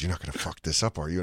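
low, soft menacing question; quiet threat. Retold or imitated. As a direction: threaten him in a low hush. Girlfriend threatens him.

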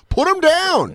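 very high, loud squeaky voice; shrill mock exclamation. Someone urging grandma to put the dog down, squeaky high. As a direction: squeal it like a cartoon. Voicing exasperated reaction.

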